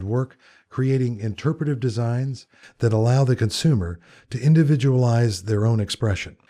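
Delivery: smooth announcer read over the music; podcast host intro. Acted. Host opening his design podcast for listeners, polished announcer read. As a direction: present the show and the guest like a radio host.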